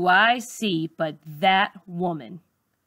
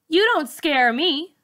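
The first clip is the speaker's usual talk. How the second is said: higher, defiant; defiant teen; 7 semitones higher than usual. A narrator's reading. Charlize defying the new teacher, high defiant retort. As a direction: stand up to her.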